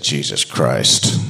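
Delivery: low, slow exasperated groan. Retold or imitated. Fed-up father at the door late at night, low exasperated sigh. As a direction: sigh it out, at the end of his rope.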